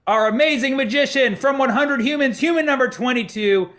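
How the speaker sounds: rousing emcee intro, stretching the final name; show announcer introduction. Real, not acted. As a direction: announce the act, ending in a big shout. Host introducing the magician on stage.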